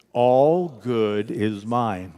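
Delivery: extremely slow, stretched-out words; drawn-out congregational affirmation; slow group affirmation. Real, not acted. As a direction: draw out each word for unison. Leads repeated affirmation, slower.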